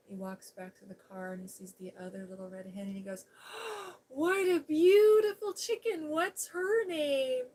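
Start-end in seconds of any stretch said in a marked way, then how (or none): 3.3-4.0 high sharp gasp of delight; high breathless gasp of wonder. Retold or imitated. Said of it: "he's gushing over the chicken". 4.1-7.4 high, loud, slow swooning over the hen; loud high-pitched awestruck admiration; gushing over a chicken. Retold or imitated. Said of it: "he's gushing over the chicken".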